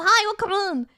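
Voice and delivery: bright high-pitched hello; cheery greeting. Real, not acted. Streamer greets arriving viewers, cheery. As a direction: call out a high, welcoming hello.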